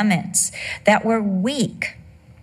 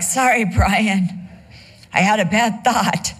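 sheepish apology. Pretend. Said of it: "I'd be like".

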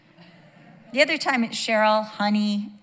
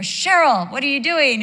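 loud, raised-pitch retold protest; mimicked husband protesting; 6 semitones higher, 9 dB louder than usual. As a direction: protest loudly as the woken husband. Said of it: "It's not like, even like".

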